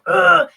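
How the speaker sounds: loud, high exasperated "ugh"; frustrated grunt. Retold or imitated. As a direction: imitate a frustrated toddler's groan. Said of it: "he's outwardly frustrated".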